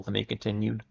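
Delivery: plain reading voice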